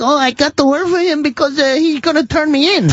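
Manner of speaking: high-pitched mock immigrant worker. Immigrant worker explains why he stays, high mock-fearful voice. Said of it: "like, immigrants that he literally enslaves".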